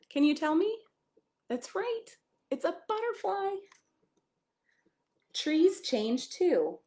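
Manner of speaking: gentle picture-book read-aloud; storybook voice for a small child. Acted. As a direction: read it like a bedtime story to a little one.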